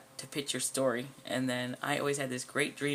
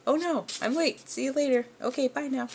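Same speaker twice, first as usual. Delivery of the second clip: put-on high, hurried girlish voice; bright cartoon schoolgirl in a rush; flustered girl; 11 semitones higher, 3 dB louder than usual. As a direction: squeak it out like a girl running late. Voicing Coco rushing out.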